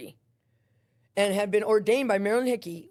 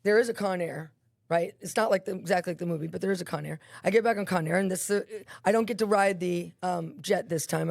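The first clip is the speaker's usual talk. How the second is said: usual speaking voice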